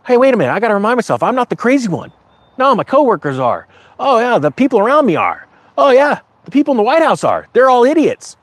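worked-up rant. Retold or imitated. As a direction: rattle it off high and fired up. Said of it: "I'm like".

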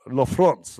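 French accent with the line falling at the end; self-conscious French accent try. Shown as a demonstration. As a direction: practise "La France" in a French accent, ending low. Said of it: "My French accent".

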